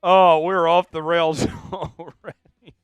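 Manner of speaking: slow words broken by laughter; laughing hard. Real, not acted. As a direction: try to talk through a fit of laughter. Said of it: "I'm laughing so hard".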